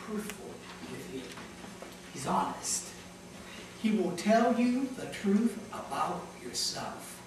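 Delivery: normal talking voice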